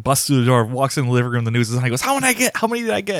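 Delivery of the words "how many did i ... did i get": high-pitched, eager mock outburst; excited retelling. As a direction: imitate someone bursting in, thrilled to know the score. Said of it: "like, so excited".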